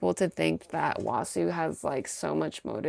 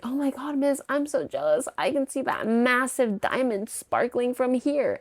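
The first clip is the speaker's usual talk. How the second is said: gushing fan; 7 semitones higher than usual. Retold or imitated. Audience member gushing about ring.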